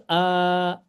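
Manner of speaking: "a" held long as an open aah; long a vowel. Shown as a demonstration. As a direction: stretch "a" out into a sustained sound. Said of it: "we pronounce it as a".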